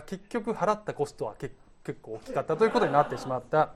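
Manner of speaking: ordinary talk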